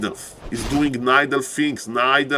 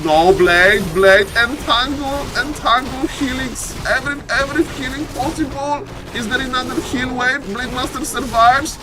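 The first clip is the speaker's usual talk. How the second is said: frantic play-by-play; 11 semitones higher than usual. Real, not acted. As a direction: shout the rescue as it happens. Frantic fight, hero survives.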